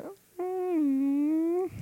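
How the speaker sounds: high, put-on disappointed whimper; mock whine. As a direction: squeak out a mock whiny mm. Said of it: "Austin was like".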